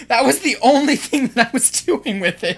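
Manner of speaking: laughing. Real, not acted. Laughs at opponent's choice.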